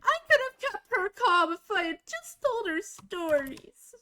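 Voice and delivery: high, teary half-wail; hammed-up crying voice; mock upset. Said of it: "I was so upset".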